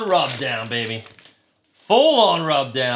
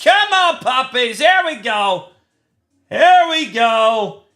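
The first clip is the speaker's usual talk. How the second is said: very high, loud screaming of the hit; hype shout; 7 dB louder than usual. Real, not acted. Streamer screaming to viewers over a rare pull, very high and loud. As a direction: yell it out like a jackpot win. Golden mirror variation pulled.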